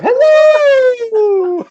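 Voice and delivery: very high, loud, stretched-out greeting; hammed-up falsetto nanny call; Missus Doubtfire. Likening the offended woman to a film nanny, falsetto. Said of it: "she turned into Missus Doubtfire".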